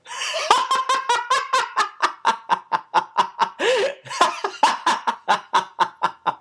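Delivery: long high helpless laughter; shout of laughter. Acted. Chris bursts out laughing at the woman. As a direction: laugh until you can barely stop. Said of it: "only added to Chris's laughter".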